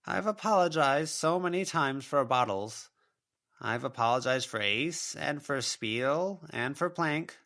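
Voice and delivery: a mother's ghost listing her excuses; self-pitying spectral mother; wounded ghost mother. A narrator's reading.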